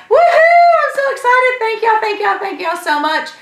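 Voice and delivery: high, loud whooping joy, then settling; ecstatic cheering. Retold or imitated. Thanking the store team on the phone, whooping with joy. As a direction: cheer like you just won big.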